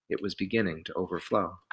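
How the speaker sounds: ordinary delivery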